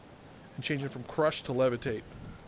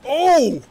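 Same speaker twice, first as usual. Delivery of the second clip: high, loud "oh!"; startled cry; 12 semitones higher, 10 dB louder than usual. Real, not acted. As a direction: cry out at a shocking hit. Startled by violent hit in game.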